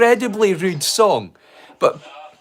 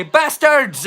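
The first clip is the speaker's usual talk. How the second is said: higher crowd-style cheer inside an anthem; shouted cheer; 6 semitones higher, 3 dB louder than usual. Shown as a demonstration. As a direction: shout it out like the terrace response. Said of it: "the cheer is in the middle".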